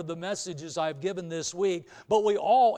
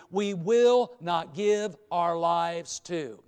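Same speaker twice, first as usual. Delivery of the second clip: slow, louder, weighted sermon statement; emphatic preaching; 4 dB louder, slower than usual. Acted. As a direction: drive each phrase home to the church.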